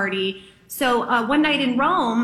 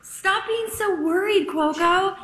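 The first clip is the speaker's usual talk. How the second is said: teasing friend; 7 semitones higher, slower than usual. Retold or imitated. Friend teasing her worry.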